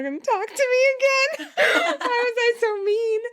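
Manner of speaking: squeaky high laugh through the words, real amusement. Real, not acted. Laughs at own bluntness.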